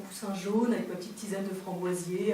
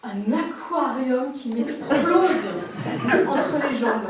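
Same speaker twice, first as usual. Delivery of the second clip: en riant; 2 semitones higher, 6 dB louder, much slower than usual. Retold or imitated. Raconte la poche des eaux.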